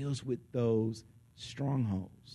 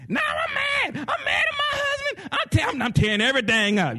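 much higher, louder hammed-up yell; mock furious shout; 7 dB louder than usual. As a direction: play the raging spouse at full volume. Angry spouse acted, shouting.

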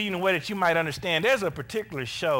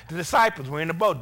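ordinary delivery

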